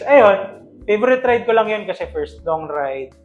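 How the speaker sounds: ordinary talk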